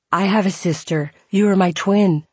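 put-on Scottish accent. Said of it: "He had a thick Scottish accent".

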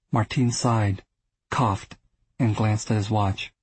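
usual speaking voice

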